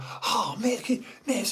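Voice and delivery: high eager pupil calling miss; pupil calling teacher. Retold or imitated. Troublemaker pupil calling the teacher, high and eager. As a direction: hand up, keen for attention. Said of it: "I would sit there in class and be like".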